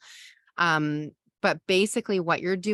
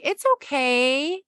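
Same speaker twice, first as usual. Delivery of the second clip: high, bright, sing-song reassurance; cheerleading; 7 semitones higher, 3 dB louder than usual. Shown as a demonstration. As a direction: brush it off like an upbeat cheerleader.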